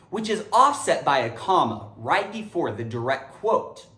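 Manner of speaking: normal talking voice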